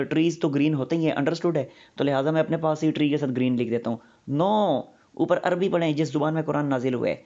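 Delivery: ordinary delivery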